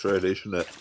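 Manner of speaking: ordinary delivery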